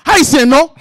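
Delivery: loud, high mock interrogation; rude questioning. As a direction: imitate someone bluntly demanding an answer. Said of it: "Don't be a rude piece of shit".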